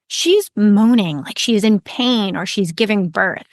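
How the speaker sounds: usual speaking voice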